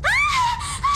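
sudden high shriek of fright; a short piercing scream in the dark; startled scream. Acted. Stowaway startled in the dark, short high scream. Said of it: "Ne crie pas".

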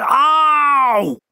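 a sudden high loud shriek of pain; sharp high squawk of pain; owl scream. Acted. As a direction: yelp like a bird yanked from behind. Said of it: "it broke into a loud scream".

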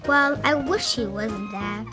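usual speaking voice

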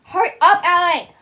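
loud, high impatient shout; impatient yell. Real, not acted. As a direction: yell at your friend to get moving. Hurrying the reader along again.

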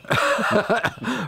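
a burst of high laughter; bright amused laugh. Real, not acted. Laughs at his own fall.

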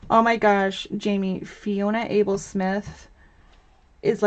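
ordinary delivery

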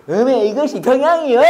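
mock Jeolla country accent, with a laugh; Jeolla dialect. Spy gives himself away asking for Pyongyang, mock Jeolla accent. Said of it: "전라도 dialect".

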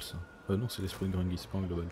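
normal talking voice

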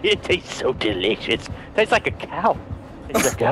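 gloating monster. Acted. Killer relishing blood in deep voice.

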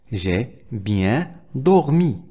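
very slow teaching model; slow, word by word. Shown as a demonstration. Teacher models a French sentence for learners, slow word by word. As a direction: pause between every word.

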